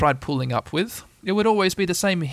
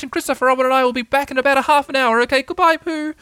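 much higher, louder squeaky Piglet call; excited Piglet calling; 11 semitones higher, 5 dB louder than usual. Acted. Piglet calling to the prisoners, high and excited. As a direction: squeak it out excitedly through the slot. Piglet calls through letterbox.

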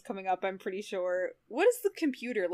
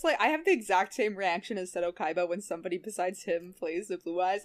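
ordinary talk, nothing marked

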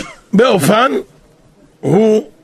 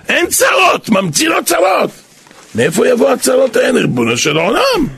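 mock shout; 9 semitones higher than usual. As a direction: call out צרות high and strained. Servant's master in a parable.

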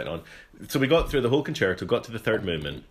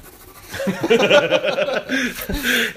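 long high laughing fit; burst of laughter; 13 semitones higher, 6 dB louder than usual. Real, not acted. Breaking off the story, cracking up laughing.

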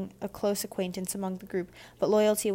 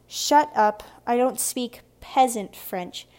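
loud, lifted snobbish retort; slow, stressed sneer at an inferior; smirking scorn; 4 semitones higher, 6 dB louder, slower than usual. A narrator's reading. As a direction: snap back with haughty disdain. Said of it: "Frances added with a smirk".

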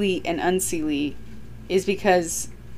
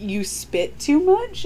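ordinary talk, nothing marked

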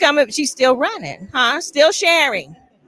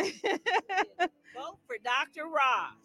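laughing; 5 semitones higher, 6 dB quieter, much slower than usual. Real, not acted. Laughing campaign plug.

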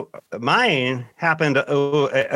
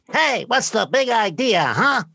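put-on high, squeaky five-year-old demanding an answer; squeaky little kid; 8 semitones higher than usual. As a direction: play a cheeky little boy with fists on hips, pitched way up. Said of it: "he's got kind of a squeaky voice".

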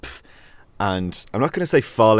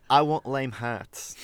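slow, softer mock Kiwi accent; 5 semitones higher, 5 dB quieter, much slower than usual. Host repeats [name]'s demand in a slow mock Kiwi accent. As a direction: drag it out in a half-hearted New Zealand accent. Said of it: "that's my best Kiwi accent".

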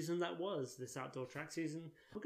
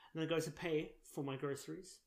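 usual speaking voice

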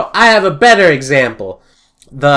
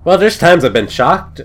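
ordinary talk, nothing marked

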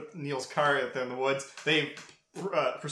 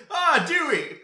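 startled scream; 10 semitones higher, 4 dB louder, much slower than usual. Retold or imitated. Sydney startled by Dewey.

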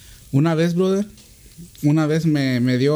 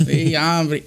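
usual speaking voice

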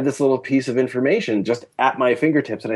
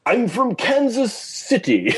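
high, slow, grand mock stage voice with a laugh; Angus Scrimm's stentorian voice; 14 semitones higher, slower than usual. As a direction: play up a lofty actor's voice, amused. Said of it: "who has this stentorian voice".

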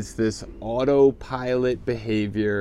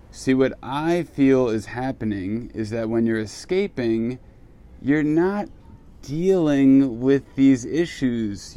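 ordinary delivery

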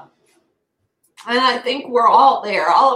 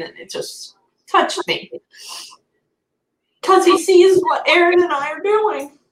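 higher, breathy voice on the edge of tears; talking through real tears; moved to tears while speaking; tearful voice; 7 semitones higher than usual. Real, not acted. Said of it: "He made me cry".

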